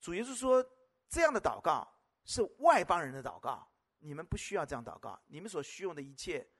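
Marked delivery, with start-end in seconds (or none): none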